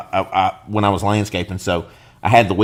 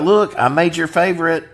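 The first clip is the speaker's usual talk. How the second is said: mock mom; 8 semitones higher than usual. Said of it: "mom will be like".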